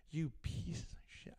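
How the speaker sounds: muttered under the breath; quiet mock fury; hushed retold insult. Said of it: "I am gonna go nuts".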